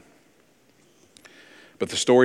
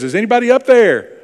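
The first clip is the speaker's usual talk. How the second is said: much higher and louder, calling up from a cliff; desperate cry for help; 11 semitones higher, 7 dB louder than usual. Retold or imitated. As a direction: yell up for help, hanging on. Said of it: "And he says".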